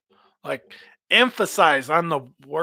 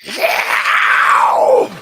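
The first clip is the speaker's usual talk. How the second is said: much higher, louder raspy scream; high throat scream; 6 dB louder than usual. Shown as a demonstration. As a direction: rip it from the top of the throat. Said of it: "growl from the top of your throat".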